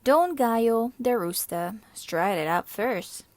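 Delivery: ordinary delivery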